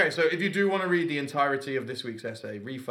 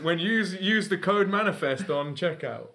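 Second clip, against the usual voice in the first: higher, hammed-up advert voice; mock ad read; 7 semitones higher, 3 dB louder than usual. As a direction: join in as the ad announcer. Joining the parody ad.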